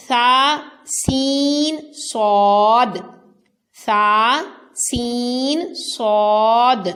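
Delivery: ثا, سین and صاد sounded loud and distinct; صاد drawn out slow and heavy, سین kept thin; similar letters contrasted. Shown as a demonstration. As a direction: repeat ثا سین صاد carefully apart. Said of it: "حروف قریب الصوت".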